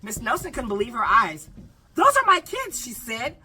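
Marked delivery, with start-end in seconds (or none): 1.9-2.8 high, loud startled teacher line; lightly scratched, shocked cry; a hint of a scratchy shocked voice. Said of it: "in a scratchy voice".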